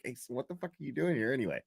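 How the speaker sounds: a quick muttered courtroom question; casual hushed ask. Retold or imitated. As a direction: toss it off under your breath. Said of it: "I asked him just like that".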